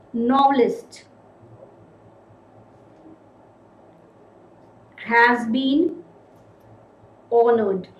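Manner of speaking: each word set apart with long pauses; slow dictation. Shown as a demonstration. Teacher dictating the sentence to the class. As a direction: read it out word by word as if writing. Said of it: "My first sentence is".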